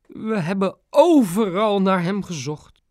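slow, sniffly high plea; dad sniffling as he comes home. A narrator's reading. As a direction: sniff through the words, a touch of tears. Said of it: "snifte pap".

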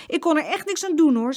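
high, loud plea of innocence; protesting child. A narrator's reading. Jeroen defends himself to mama, high protesting voice.

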